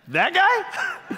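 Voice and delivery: high, laughing disbelief; can't believe the choice; incredulous squeak. Retold or imitated. Trinity objecting to choosing Saul.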